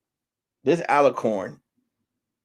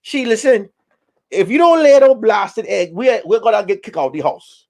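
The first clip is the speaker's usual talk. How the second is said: higher, louder telling-off; exasperated scolding; 5 semitones higher, 4 dB louder than usual. Retold or imitated. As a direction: snap at her in frustration. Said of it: "I say".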